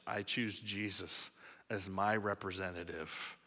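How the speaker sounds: whisper-soft surrender to God; hushed prayer. Shown as a demonstration. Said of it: "To uphold the law is to say".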